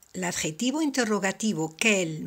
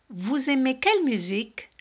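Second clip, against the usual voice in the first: "Vous aimez quelle musique?" with voice raised toward the end; rising question intonation; 5 semitones higher than usual. Shown as a demonstration. Teacher showing students how French intonation questions rise. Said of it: "Subimos un poquito la voz al final".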